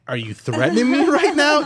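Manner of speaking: retold startled confrontation; shocked disbelief. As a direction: blurt it out in shocked alarm. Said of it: "And I go".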